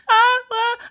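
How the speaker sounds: high, loud mock sobbing; upset crying child. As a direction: start bawling like an upset kid. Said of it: "like, upset".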